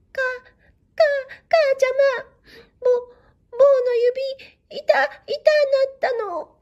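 put-on wailing child stuttering through tears to his mother; crying child. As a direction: sob out each broken word like a small child. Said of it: "泣きながら言い言いする".

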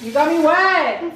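sprayed with water. Real, not acted. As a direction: caught off guard by a splash.

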